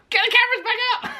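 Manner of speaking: high, fast, laughing cry; giddy high cry to bring the cameras back; laughing excitement. Retold or imitated. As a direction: blurt it out through laughter. Excited thought after speed camera.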